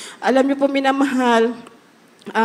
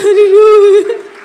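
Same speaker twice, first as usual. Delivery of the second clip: joyful laughing praise; 8 semitones higher, 10 dB louder, slower than usual. Real, not acted. Overcome with joy at rescue.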